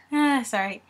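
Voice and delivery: ordinary delivery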